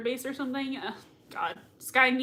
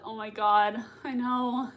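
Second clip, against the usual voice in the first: ordinary talk, nothing marked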